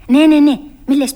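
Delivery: loud, emphatic yes yes yes; loud insisting. Acted. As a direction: insist over the kitten's denial. Alice insists to the kitten. (δυνατή επιμονή)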